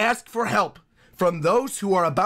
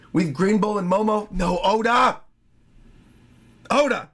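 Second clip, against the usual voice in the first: ordinary talk, nothing marked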